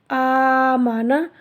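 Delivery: आमाना recited slowly, every a held long; drawn-out Qur'an reading. Shown as a demonstration. Teacher reading the joined word to pupils, slowly drawn out. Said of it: "नून ज़बर ना".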